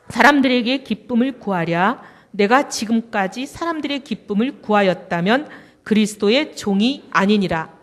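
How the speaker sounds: loud slow unison recitation; reading scripture aloud together. Real, not acted. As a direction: declaim the verse slowly and loudly with the congregation. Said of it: "합독하겠습니다".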